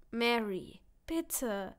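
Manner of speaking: begging. Acted. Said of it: "flehe ich ihn an".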